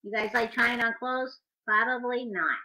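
ordinary delivery

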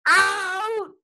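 high, loud ref call; umpire's cry. A narrator's reading. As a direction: call it out like a referee. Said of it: "cried the ref at first base".

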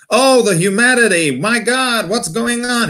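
high, loud, hammed-up American anguish; dramatic American commentator. American reporter watching the Hindenburg disaster, melodramatic mock horror. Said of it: "that was an American".

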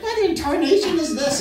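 a hint of a shocked mother; lightly voiced shocked mom; mild mock mom. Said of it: "my mom would be like".

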